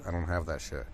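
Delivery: ordinary talk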